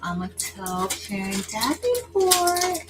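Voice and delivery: high sassy friend voice; imitating Marilyn. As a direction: mimic a teasing friend. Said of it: "That's what she says".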